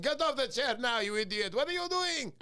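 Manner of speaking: high-pitched mock-angry telling-off; imitated scolding father. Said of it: "he would have been like".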